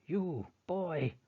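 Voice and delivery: loud, high snarling accusation. Acted. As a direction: snarl at the boy. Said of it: "snarls Father".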